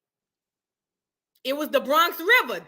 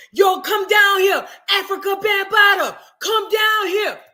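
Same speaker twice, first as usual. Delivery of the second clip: loud, higher slow holler from the doorway; 7 semitones higher, 7 dB louder than usual. Retold or imitated. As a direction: call down the street like a shout out. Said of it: "in front of the door hollering".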